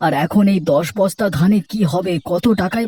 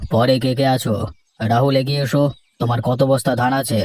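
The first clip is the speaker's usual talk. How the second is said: lower, measured headman's voice; village headman; 6 semitones lower than usual. Acted. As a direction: speak down to the farmers as the headman, lower. Headman calls next farmer.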